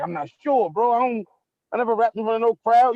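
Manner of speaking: retold protest. Retells own hesitant protest.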